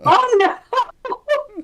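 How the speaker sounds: high "oh no" through real laughter; laughing dismay. Real, not acted. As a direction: crack up at the awkward reveal. Listener cracks up at reveal.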